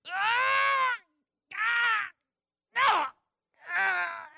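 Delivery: strained high yelling grunts, getting weaker; hammed-up struggle to open a stuck jar lid; straining grunts. Pastor acting out fighting a jar lid at home, strained high yells. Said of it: "and I'll be like".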